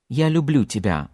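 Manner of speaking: тебя ending in a weak, reduced ya; reduced final Ya. Shown as a demonstration. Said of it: "this reduced sound at the end".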